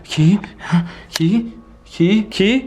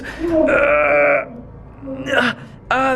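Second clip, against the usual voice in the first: long straining groan; pained groan; much slower than usual. Acted. Forcing a hand toward the key, pained straining groan. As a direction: groan with effort and pain. Hand hurting while reaching.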